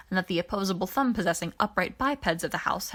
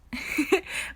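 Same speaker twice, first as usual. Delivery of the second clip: a quick rising giggle; short breathy little giggle; 8 semitones higher than usual. Acted. Pidge giggling at the idea, a quick rising giggle. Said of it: "Pidge giggled".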